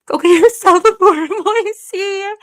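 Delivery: high and loud, laughing through the words. Real, not acted. Streamer reading a kind chat message, laughing through it. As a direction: laugh as you read it out.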